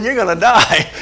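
punchline repeated while laughing; can't hold back the laugh on the line. Retold or imitated.

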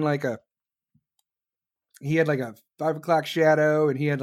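ordinary talk

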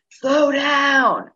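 high, loud call after someone; yelled warning. Retold or imitated. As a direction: shout it after a friend running off. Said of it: "He yelled after me".

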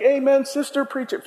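mock churchgoer shouting amen; lightly voiced congregant egging on; call from the pews. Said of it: "you're getting a bunch like".

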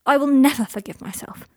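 fiercely emphatic refusal. A narrator's reading. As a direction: come down hard on NEVER. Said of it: "said Draco fiercely".

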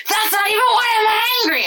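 mock the husband's frustrated protest; husband's outburst. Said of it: "my husband will be like".

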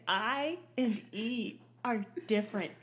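over-enunciated vowels, "I" and "E" held apart; slow, deliberate diction lesson; proper mother. Retold or imitated. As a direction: spell it out like an insistent mom. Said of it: "I'll say it again".

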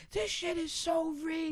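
very high, slow, soft mock yell; mock yelling kid. Losing kid complains to booth worker, high-pitched mock yell. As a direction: squeal it like a complaining kid, without volume. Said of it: "They wanna yell at me".

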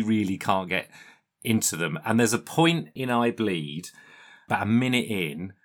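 ordinary talk

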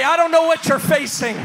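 loud rising preacher cadence; fiery sermon climax; preaching at full force. Acted. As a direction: preach it out to the congregation. Preaching build.

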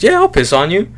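high-pitched, casual mock agreement; imitating an easygoing stranger; acting cool. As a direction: agree as if it's no big deal. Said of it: "being all, like, cool and shit about it".

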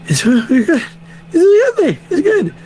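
very high, choked-up squeak; relieved reaction, voice shot way up; high relieved squeal. Retold or imitated. Overwhelmed relief at child's news.

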